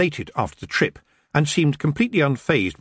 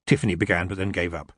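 plain reading voice

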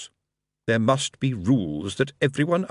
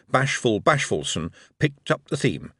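ordinary delivery, nothing marked

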